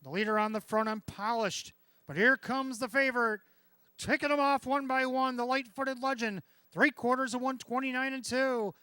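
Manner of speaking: loud, high stretch call; excited race caller. Real, not acted. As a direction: build to a roaring finish. Stretch drive building.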